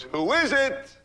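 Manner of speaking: high, lightly voiced woman's call; woman at the door. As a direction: answer the knock as the girl. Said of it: "She goes".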